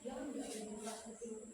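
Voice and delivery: very soft, low hushed words; hushed scared quote. Retold or imitated. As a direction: hush it like a frightened child. Teacher quotes scared child.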